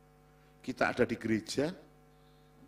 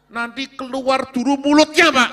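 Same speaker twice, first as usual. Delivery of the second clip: wife's laughing complaint; 12 semitones higher, 13 dB louder than usual. Retold or imitated.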